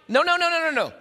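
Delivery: rapid high refusals; emphatic no. Acted. Preacher rejecting a wrong idea, fired up and high. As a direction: wave the idea away, fired up.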